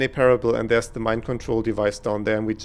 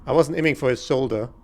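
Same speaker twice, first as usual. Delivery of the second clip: ordinary talk, nothing marked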